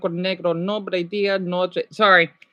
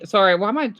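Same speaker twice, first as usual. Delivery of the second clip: ordinary talk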